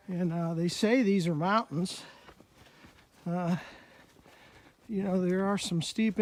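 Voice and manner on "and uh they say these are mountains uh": usual speaking voice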